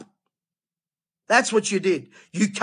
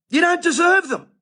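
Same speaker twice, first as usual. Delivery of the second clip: sharply higher, indignant jab at the churches; indignant outburst; 8 semitones higher than usual. Real, not acted. Preacher scolding church leaders, indignant high outburst. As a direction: spit it out at the leaders you're fed up with.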